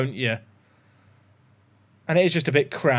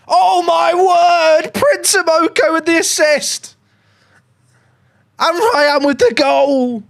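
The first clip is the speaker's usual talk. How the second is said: loud, high, drawn-out commentator cry; goal celebration; 11 semitones higher, 5 dB louder, slower than usual. Real, not acted.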